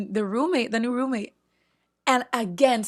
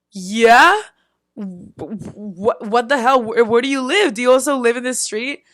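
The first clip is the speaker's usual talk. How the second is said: surprised; 2 semitones higher, 7 dB louder than usual. Retold or imitated. Neighbor says she lives nearby.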